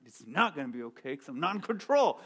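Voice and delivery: whispered complaint back to the wife; fretful whispered reply; grumbling whisper. Retold or imitated. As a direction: hiss it under your breath. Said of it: "I'm like".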